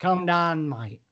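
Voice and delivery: mock Cockney, dropping away on the last word; London accent. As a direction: tell a pal off in playful Cockney. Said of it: "that's London".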